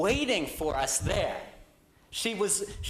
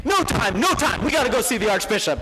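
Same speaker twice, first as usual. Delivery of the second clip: louder, fast and pushy: no time; hurried urgent brush-off; 2 semitones higher, 7 dB louder than usual. Retold or imitated. Nick hurrying his friend past the girlfriend, urgent and loud. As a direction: rush them along, we're late.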